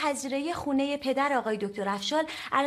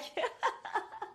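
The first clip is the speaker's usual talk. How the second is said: breathy laugh; 6 semitones higher, 9 dB quieter than usual. Acted. Laughter trailing off.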